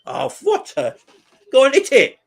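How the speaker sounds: high, loud burst of excitement; excited urging. Real, not acted. Fan watching a shot at goal, excited urging. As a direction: shout them on to shoot, pitch soaring.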